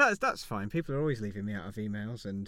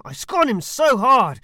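louder, higher, hammed-up scorn; escalating scorn; 11 semitones higher, 17 dB louder, slower than usual. Roman satirist outdoing himself in mock scorn, loud. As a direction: push the contempt further, over the top.

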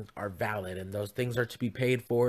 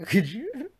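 high, loud laughing speech; words squeezed through laughter; 9 semitones higher, 4 dB louder, slower than usual. Real, not acted. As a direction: crack up at your own joke. Cracks up at own Gaelic claim.

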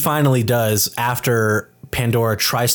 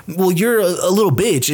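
bickering sister; 6 semitones higher than usual. Retold or imitated. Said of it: "going back and forth at each other".

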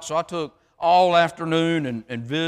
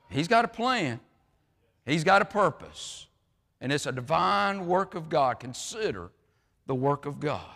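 ordinary talk, nothing marked

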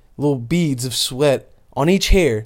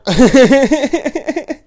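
high burst of laughter; 11 semitones higher, 7 dB louder than usual. Real, not acted. Laughs at own frog voice.

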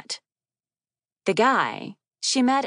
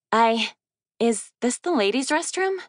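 higher, halting and flustered, "I, is this the ladies' restroom?"; stammering; 6 semitones higher than usual. Acted. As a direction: stumble over it, embarrassed and unsure. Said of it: "she stammered".

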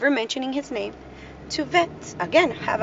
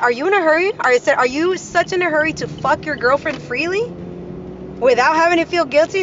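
retold confrontation; 6 semitones higher, 4 dB louder than usual. Retold angry confrontation with husband.

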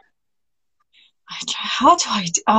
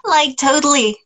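put-on higher Valley Girl lilt; Valley Girl accent; 5 semitones higher than usual. Shown as a demonstration. Guest attempts it on request, Valley Girl accent. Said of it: "do the Valley Girl accent".

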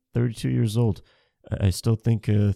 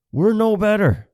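high, loud, weight on "We're"; stern scolding; 10 semitones higher, 6 dB louder than usual. Retold or imitated. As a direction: land "We're" hard and high. Repeated scolding line.

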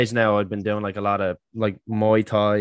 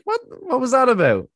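much higher, louder baffled cry; retold shock; 14 semitones higher, 5 dB louder than usual. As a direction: react in stunned disbelief. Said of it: "I was just like".

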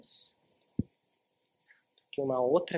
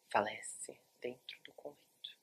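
hushed, slow whisper; somber whisper; 2 semitones lower, 9 dB quieter than usual. A narrator's reading. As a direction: breathe the death news softly. Somber death news. (sussurro sombrio e lento)

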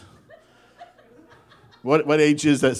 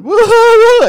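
very high, loud hammed-up sobbing; cartoonish boo-hoo cry; mock boo-hoo wail; 13 dB louder, slower than usual. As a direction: wail like a toddler turning on the tears. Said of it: "he went to boo-hooing".

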